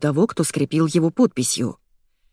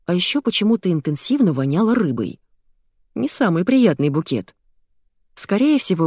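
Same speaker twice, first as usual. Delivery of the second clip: plain reading voice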